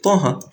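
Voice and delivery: "Taha" sung out high, two beats, falling; recited demo. Shown as a demonstration. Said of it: "How are you gonna pronounce it or recite it?".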